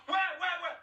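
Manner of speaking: shrill high burst; startled panic. Acted. Girl startled by a rat in the dark. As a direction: jump at the thought of a rat.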